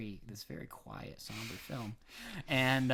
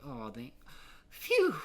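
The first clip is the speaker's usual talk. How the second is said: relieved phew; 4 dB louder, slower than usual. Retold or imitated. Relieved exhale in quote.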